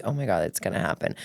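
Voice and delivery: low, soft retold mutter; quiet mock dread under the breath; hushed aside. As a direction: drop the voice right down, as if warning oneself. Retold dread of giggles coming.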